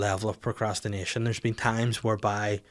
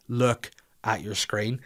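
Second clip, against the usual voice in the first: slow insistence; slower than usual. Retold or imitated. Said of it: "She goes".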